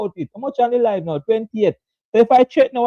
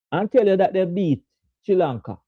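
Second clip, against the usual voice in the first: ordinary talk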